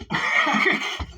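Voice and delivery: high burst of disbelieving laughter; high, breathy laugh of shock; incredulous laugh. Real, not acted. Reacting to absurd clip.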